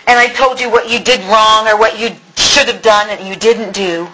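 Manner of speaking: usual speaking voice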